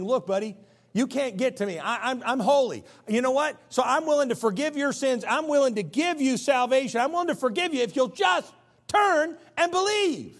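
high, loud preaching that climbs into a shout; full-force preaching. Acted. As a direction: preach God's offer to the sinner, building to a cry. Preaches God's offer at full force.

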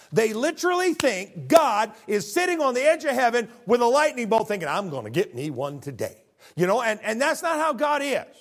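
loud, high, driving pulpit voice; fiery preaching. Acted. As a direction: preach it hard to the congregation. Preaching at full force.

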